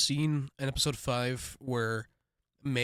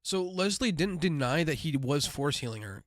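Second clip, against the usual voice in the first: ordinary delivery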